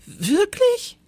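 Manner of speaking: high startled question; little teacher startled by the rain news; shocked. A narrator's reading. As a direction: gasp it out in shock. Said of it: "riss entsetzt die Augen auf".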